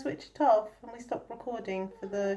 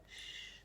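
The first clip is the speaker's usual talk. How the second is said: shushing; 24 dB quieter than usual. Real, not acted. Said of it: "so that bit's a secret".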